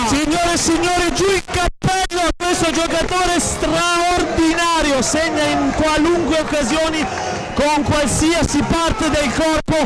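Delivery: high, slow, excited tribute; breathless italian goal commentary. Real, not acted. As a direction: hail the scorer like a showman. Co-commentator hails the goal scorer.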